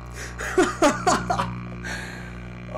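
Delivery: high unvoiced nervous laugh; shaky relieved laughter; high breathless laugh. Real, not acted. After a jumpscare.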